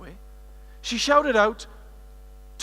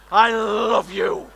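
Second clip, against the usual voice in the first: slow, louder, a lightly put-on voice; swooning; 5 dB louder, much slower than usual. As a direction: croon it to a heartthrob. Crooning at an imagined celebrity.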